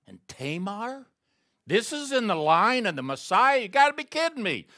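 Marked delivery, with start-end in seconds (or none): 1.6-4.7 much higher, louder and fast, in disbelief; incredulous outburst. Acted. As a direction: throw it out like you can't believe it. Preacher's incredulous exclamation.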